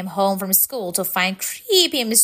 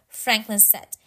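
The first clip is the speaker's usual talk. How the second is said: ordinary delivery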